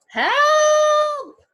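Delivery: high, loud shout for help; cry for help. Acted. Fiona shouting for help as her basket is taken. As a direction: scream for help.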